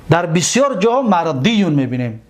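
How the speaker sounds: ordinary talk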